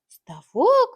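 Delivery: high, loud stunned question; shocked cry. Acted. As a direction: gasp it in disbelief. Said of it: "хором вигукнули кури". (високий гучний здивований вигук)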